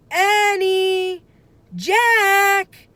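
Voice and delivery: high, loud long call across the yard; calling from far off. A narrator's reading. Mother calling her kids home from far away, loud call. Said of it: "she called".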